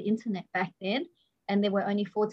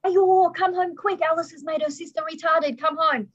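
high, loud put-on mother's voice shouting down the phone; a panicked mother summoning the dad home; imitated frantic mother; 7 semitones higher, 5 dB louder than usual. Mother phoning dad to come home, frantic shout. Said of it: "the first thing she says is".

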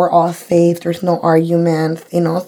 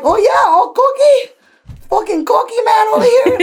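mocking taunt; 14 semitones higher than usual. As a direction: taunt the cocky man in a squealed sing-out. Mocking the cocky driver back.

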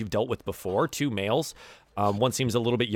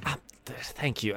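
tiefling voice; 9 dB quieter than usual. Acted. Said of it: "the tiefling says".

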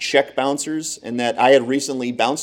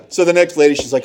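ordinary delivery

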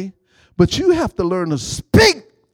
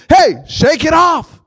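loud, higher call to shake it off; rally the church; urging shout; 8 semitones higher, 6 dB louder than usual. Real, not acted. Preacher urging congregation.

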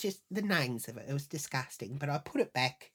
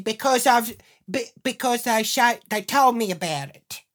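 loud, high, stumbling excuse; flustered defence; 7 semitones higher, 9 dB louder than usual. Retold or imitated. Wife caught out by her husband, blurting a loud flustered excuse. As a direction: blurt it out, raised voice tripping over itself.

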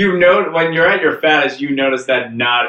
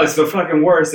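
usual speaking voice

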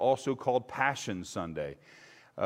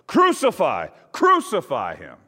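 loud, high angry cry; crowd shout; 6 semitones higher, 10 dB louder than usual. A narrator's reading. Chief priests shouting at Pilate for crucifixion, loud and high. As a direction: yell it like a furious mob. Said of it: "they shouted".

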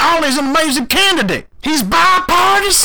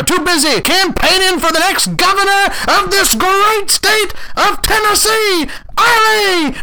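crazed campaign yelling; 4 semitones higher than usual. Acted. D A yelling at passersby for Ollie, crazed shouting. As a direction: whip the crowd up like a maniac. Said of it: "you going apeshit crazy on people".

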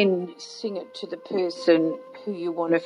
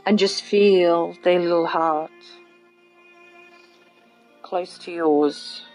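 guided meditation. Acted. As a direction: gently lead them inward. Leads a guided visualization.

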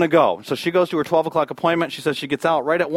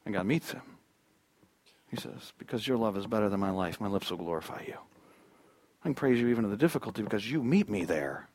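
low, very soft psalm reading; hushed reverence; 5 semitones lower, 10 dB quieter than usual. Acted. Preacher voices David praising God in difficulty, hushed and reverent. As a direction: bring it right down, low and intimate.

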